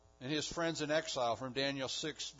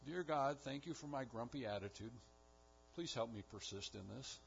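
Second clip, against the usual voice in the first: mock grumpy prayer; 5 semitones lower, 7 dB quieter than usual. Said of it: "and I say".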